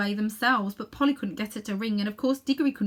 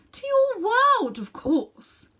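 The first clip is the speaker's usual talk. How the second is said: high, slow surprised matter-of-fact; as if it were obvious; 7 dB louder, much slower than usual. A narrator's reading. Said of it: "repeated Jadis in surprise".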